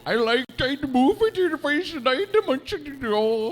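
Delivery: very high, slow, hammed-up Swedish gibberish; mock Swedish voice. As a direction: parody a singsong Swedish accent. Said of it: "I thought he had a Swedish voice".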